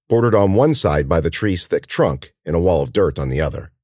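ordinary delivery

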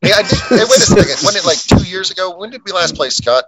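high, sudden burst of recall; excited. Real, not acted. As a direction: jump in high and eager.

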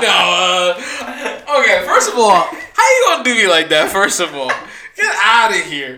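loud, laughing mock offense; laughing protest. Real, not acted.

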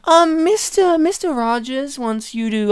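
hesitant put-on assistant passing a message; lightly squeaky secretary; secretary on phone. Secretary phoning Michael.

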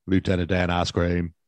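Forrest Gump. Acted. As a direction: mimic the movie character's thick Southern accent. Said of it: "I'd be like".

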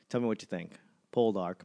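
normal talking voice

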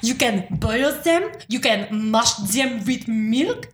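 mock French accent, quick and high; lightly French-accented bragging. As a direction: ham up a bad French cook. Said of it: "Fergus is like".

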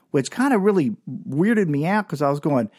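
ordinary delivery

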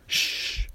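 voiceless hushing shh; mouthed shush. Acted. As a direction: silent shush through the teeth. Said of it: "mouthed Nea".

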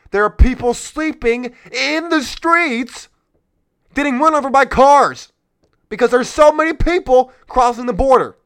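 rising into a loud, impassioned rant; heated outburst. Real, not acted. Ranting to viewers about the border crisis, loud and impassioned. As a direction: let it explode with outrage.

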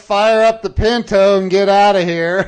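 loud, high mock cheer of fleeing fame; mock excited shout. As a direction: belt it out like a joke getaway call. Mock getaway line, very loud.

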